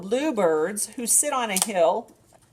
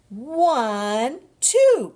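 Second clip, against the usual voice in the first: rising, louder count; excited counting; 7 semitones higher, 8 dB louder than usual. Shown as a demonstration. Librarian counting a duckling's feet excitedly. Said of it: "Can you count?".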